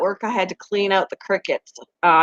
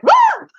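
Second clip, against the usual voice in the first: very high squeal of disgust; startled shriek; 6 dB louder than usual. Retold or imitated. Crickets landing on her at work, high squeal. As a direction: yelp as bugs land on you. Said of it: "I was like".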